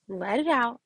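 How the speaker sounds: ordinary delivery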